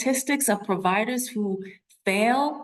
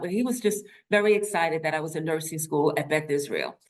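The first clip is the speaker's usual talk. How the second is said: ordinary talk, nothing marked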